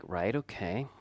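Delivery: quietly unbothered reply; unconcerned. Retold or imitated. Dad answering his daughter's news of the dislocated hip, unconcerned. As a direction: softly brush off the worry. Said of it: "You don't sound too concerned".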